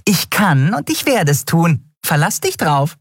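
high, gleefully nasty little voice; mean gloating. A narrator's reading. Nelen taunts Miko with a mean grin, gloating. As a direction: taunt the loser in a high-pitched voice. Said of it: "Nelen grinste fies".

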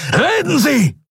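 high roared command; roaring. A narrator's reading. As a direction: bellow the order. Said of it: "brüllte Telios". (gebrüllter Befehl)

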